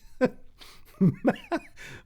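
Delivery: a rising high laugh. Real, not acted. Pleased by a chat compliment, high laughter. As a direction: let a high laugh climb.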